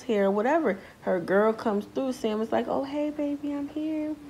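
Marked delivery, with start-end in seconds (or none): 2.7-4.2 high, soft cooing; a hint of lovey-dovey arrival; mild high coo; lightly voiced sweet girlfriend. Voicing Sam arriving sweetly.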